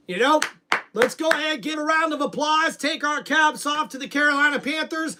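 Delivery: usual speaking voice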